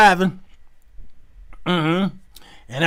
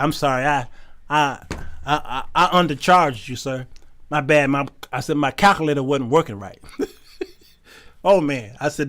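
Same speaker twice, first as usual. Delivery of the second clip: normal talking voice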